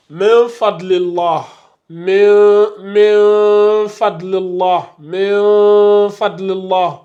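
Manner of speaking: "min fadlillah" slowly, n of min hidden in a long nasal hum; drawn-out recited "min fadlillah", n melted away; hidden noun with ghunna. Shown as a demonstration. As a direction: stretch "min" through the nose without closing the n. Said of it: "on dissimule le noun".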